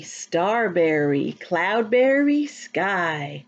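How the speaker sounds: ordinary talk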